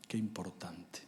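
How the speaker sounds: hushed aside to the crowd; quiet awe; whispered. Real, not acted. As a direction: let it fall to a whisper. Whispered reflection.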